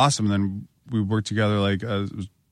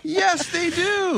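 high mock insistence; much slower than usual. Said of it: "insisting, high and slow".

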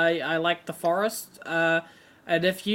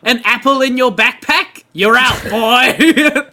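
loud barking officer, laughing; gruff border agent; 5 semitones higher, 12 dB louder than usual. Pretend. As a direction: bark it like a strict agent. Acted border agent shout.

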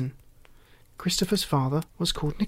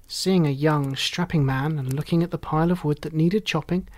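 ordinary delivery

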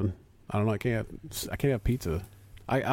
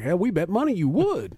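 higher-pitched mock uncle quote; imitated teasing retort; 8 semitones higher, 4 dB louder than usual. Uncle ribbing his nephew on the golf course, teasing retort. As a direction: imitate a lively, needling comeback. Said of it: "And he was like".

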